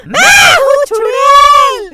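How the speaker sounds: loud, high, slow witch voice; loud slow witch proclamation. Acted. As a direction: announce yourself as a witch, drawn out. Mother plays the witch.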